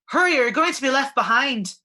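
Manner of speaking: loud impatient dwarf shout. A narrator's reading. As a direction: yell at them to hurry. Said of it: "Moira's shout".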